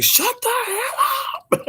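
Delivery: high squeaky mock yell through laughter; gruff husband. Imitating the neighbour's husband telling her off, mock yell. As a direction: bark it as the fed-up husband, laughing. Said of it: "Her husband is like".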